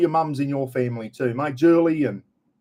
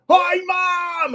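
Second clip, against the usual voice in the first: screaming into the phone. Retold or imitated. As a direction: yell it so a deaf mum hears you. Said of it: "and just scream into it".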